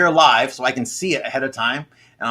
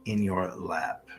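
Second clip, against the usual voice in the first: guided meditation; 3 semitones lower, 8 dB quieter than usual. Real, not acted. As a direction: guide them gently into calm, quietly and unhurried.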